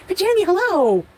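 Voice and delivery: high, loud cheerful hello; sing-song hello to a friend; excited greeting. Real, not acted. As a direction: call out a bright welcome. Greeting a viewer by name.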